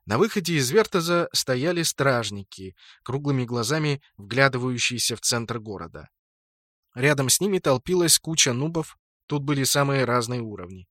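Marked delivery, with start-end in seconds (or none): none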